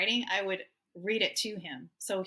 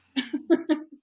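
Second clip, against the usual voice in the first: loud, high burst of laughter; joyful laugh; happy laugh; 8 semitones higher, 9 dB louder than usual. Real, not acted. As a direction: laugh out with relief. Happy ending, she laughs.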